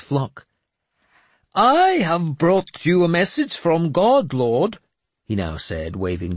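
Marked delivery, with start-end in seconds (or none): 1.5-4.8 put-on high, slow, grandly pious old madman; a cracked holy man delivering God's message; raised, slow prophet voice; mad bishop voice. Jeremias speaks to lord.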